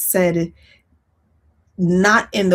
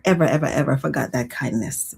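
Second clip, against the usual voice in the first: usual speaking voice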